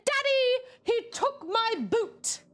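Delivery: put-on high, slow small-child voice; Bluey's Jane. Imitating a small girl complaining to her daddy. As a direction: whine like a little kid to dad. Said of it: "I need to talk like Jane".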